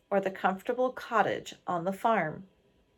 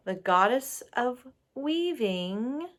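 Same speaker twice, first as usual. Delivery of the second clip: ordinary delivery, nothing marked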